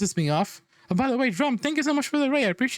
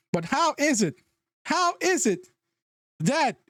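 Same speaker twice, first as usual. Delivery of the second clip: exasperated; 8 semitones higher than usual. Real, not acted. Exasperated at poor planning.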